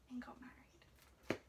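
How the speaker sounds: soft, slow whispered close; whispered ending. A narrator's reading. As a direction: hush the final words.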